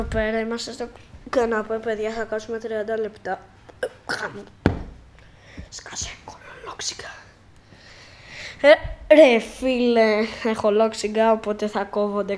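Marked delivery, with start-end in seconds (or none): 5.7-7.1 whisper. Real, not acted. Telling hiccups to shut up.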